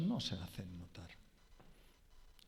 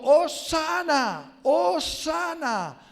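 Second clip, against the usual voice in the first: high, slow, loud Hosanna cry; crowd shouting; 14 semitones higher, 11 dB louder, much slower than usual. Retold or imitated. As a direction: call it out like the crowd at the gate. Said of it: "la gente estaba gritando".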